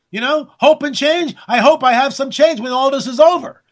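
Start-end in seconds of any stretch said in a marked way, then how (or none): none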